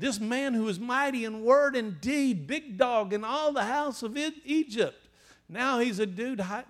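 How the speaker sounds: preaching at full force. Acted. Preacher rousing his congregation about Moses in the wilderness, loud and high.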